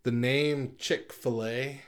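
ordinary talk, nothing marked

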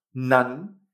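"Nan" with pitch rising to the end; second tone. Shown as a demonstration. Teacher demonstrates N A N, "Nan" in rising second tone. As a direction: let "Nan" climb as one drawn-out syllable. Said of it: "en segundo tono".

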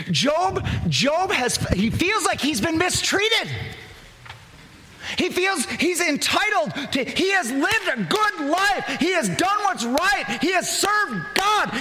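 high-pitched, insistent preaching; impassioned preaching. Real, not acted.

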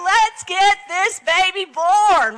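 high, drawn-out urgent call; rallying cry. Acted. Preacher rallies congregation to birth the kingdom, calling out. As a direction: urge it on like a birth coach.

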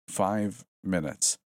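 slow, stretched intercom call; intercom screech. Acted. Intercom warning students, screeching slowly. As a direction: drag it out over the speakers. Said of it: "the intercom screeched".

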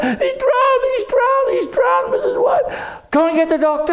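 frantic yell. Acted. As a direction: shriek for help, frantic. Jenny yelling for help.

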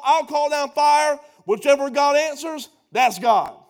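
high, loud, driving preacher cadence; fiery preaching. Acted. Preacher as Elijah daring Baal's prophets, loud and high. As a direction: throw down the contest like a dare.

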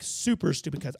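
normal talking voice

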